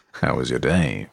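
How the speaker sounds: very deep voice. Shown as a demonstration. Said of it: "I'm gonna ask again".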